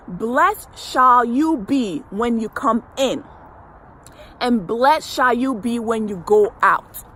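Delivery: slow, solemn verse reading; scripture reading. Acted. Coach reads the verse behind the affirmation, slow and solemn. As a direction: read the verse like a blessing.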